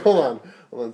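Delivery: loud, stunned hold on; mind blown. Retold or imitated. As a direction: stop everything in loud amazement. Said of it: "I was like".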